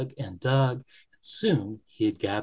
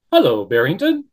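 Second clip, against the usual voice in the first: loud friendly Beaver greeting; Beaver's voice; 3 semitones higher, 10 dB louder than usual. Acted. Beaver hails Barrington, loud and friendly. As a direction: call out hello as the Beaver.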